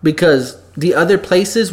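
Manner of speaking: ordinary talk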